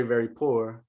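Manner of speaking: normal talking voice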